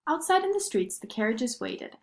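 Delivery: ordinary delivery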